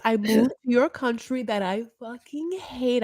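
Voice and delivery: high, slow, whiny complaining wife; mock aggrieved wife. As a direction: drag out every grievance in a high whine. S1 voicing Yara's tirade.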